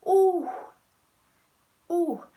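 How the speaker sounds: high-pitched rising "ooh". Real, not acted. Reacting to the chat, high-pitched ooh. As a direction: let out a high, drawn ooh.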